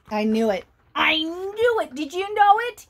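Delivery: ordinary delivery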